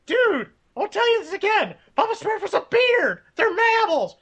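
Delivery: high, loud nerd arguing; nerdy henchman rant. Acted. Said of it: "the henchmen and Venture Brothers arguing".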